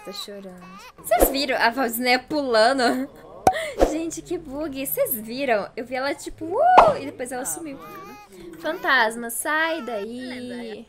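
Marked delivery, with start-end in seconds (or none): 3.1-3.9 high, loud burst of laughter; a sudden squealing laugh; shrieking laugh. Real, not acted. Laughs at jumping Sim. 6.4-7.0 squeaky "uh" pop. Retold or imitated. Imitates Sim vanishing. 8.6-10.9 mock shooing call. Shooing the ghost.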